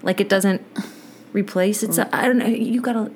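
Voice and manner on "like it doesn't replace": ordinary talk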